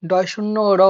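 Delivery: ড় in ডয় শূন্য র tapped off the roof of the mouth; demo of flap. Shown as a demonstration. Teacher answering a student with the flap sound. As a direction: curl the tongue and tap ড়. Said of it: "জিহাটা একটা টোকা দেবে".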